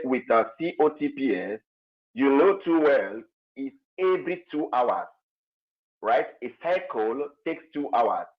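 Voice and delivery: faint, distant mic voice; almost lost, far from the mic; barely audible. Real, not acted. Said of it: "Your voice is very low".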